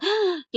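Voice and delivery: sharp, high acted gasp; mock shocked gasp. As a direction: gasp as if stunned by the demand. Acted shock at huge money demand. (அதிர்ச்சியில் மூச்சை இழுப்பது போல)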